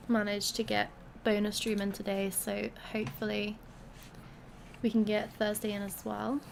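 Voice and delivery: ordinary talk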